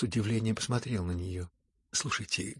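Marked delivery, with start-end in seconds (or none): none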